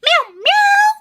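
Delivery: very high squeaky meows; high playful cat meowing. Pretend. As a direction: mew like a tiny kitten.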